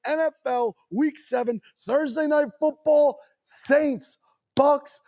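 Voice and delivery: rising, high rallying call; hype speech. Real, not acted. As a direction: pump up the crowd for battle. Hype speech before picks.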